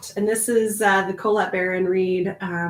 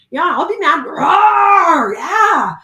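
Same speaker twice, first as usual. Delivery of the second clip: louder, higher mock roar with laughter; playful roar; 7 semitones higher, 9 dB louder, slower than usual. Joining a friend's anger, hammed-up roaring. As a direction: roar along like a playful bear. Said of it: "Let's be mad".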